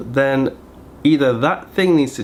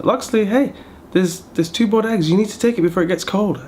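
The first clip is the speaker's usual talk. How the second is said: high, quick nagging imitation; mother-in-law urging Loxley to take eggs, mocked high; 8 semitones higher than usual. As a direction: push it up high and hurried like a fussing mother. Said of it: "she's like".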